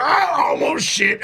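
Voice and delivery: imitated startled old man; retold alarm. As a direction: cry out in comic alarm. Old man's eye nearly falls out.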